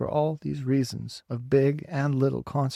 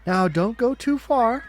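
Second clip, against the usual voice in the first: loud, high call; calling after; 9 semitones higher, 6 dB louder than usual. A narrator's reading. Teacher calling after the children, loud and high. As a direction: call after the running kids. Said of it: "called the teacher after them".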